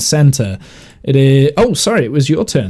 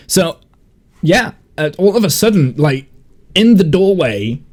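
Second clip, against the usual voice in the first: usual speaking voice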